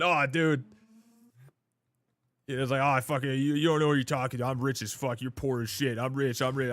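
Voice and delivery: mock bragging kid. Mocking a bragging chatter.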